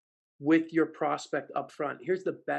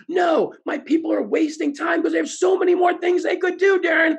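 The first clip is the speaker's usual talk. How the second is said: high, loud shouted protest; CEO shouting; 14 semitones higher, 12 dB louder than usual. Retold or imitated. CEO in the back shouts at Darren, loud protest. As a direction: blurt it out over the room. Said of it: "He goes".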